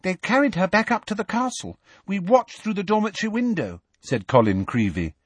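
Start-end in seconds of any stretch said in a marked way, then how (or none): 0.0-3.8 high-voiced boy reporting news; worried young pupil; young schoolboy. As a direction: report it earnestly in a small, high voice.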